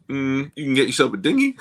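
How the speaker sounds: teasing high-voiced caller, rising at the end; lovely lady caller. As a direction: hum first, then lilt up high as the lady.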